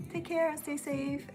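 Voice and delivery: normal talking voice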